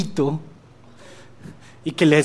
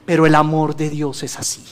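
ordinary talk

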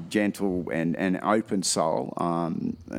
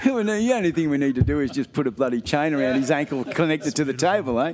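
much higher, louder, cracking up; laughing hard; 8 semitones higher, 4 dB louder than usual. Real, not acted. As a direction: laugh out the joke about chaining him to the table. Host cracking up at the joke.

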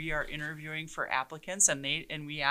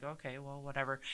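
much softer, resigned okay whatever; meek brush-off; 3 semitones lower, 12 dB quieter than usual. Retold or imitated. Young chief brushing off criticism, soft and resigned. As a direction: swallow it and back down quietly. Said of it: "I would just be like".

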